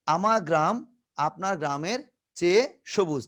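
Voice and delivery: usual speaking voice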